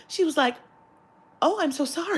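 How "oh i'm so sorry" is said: higher, sympathetic clerk voice; mock-sympathetic customer-service tone; apologetic cashier. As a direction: imitate a clerk's sweet apology.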